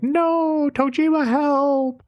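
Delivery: high, put-on girlish plea; damsel cry for help. Emily begging Tojima to save her, high and pleading. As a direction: squeak it out like a helpless heroine. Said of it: "And she's like".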